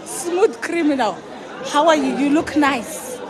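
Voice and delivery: ordinary talk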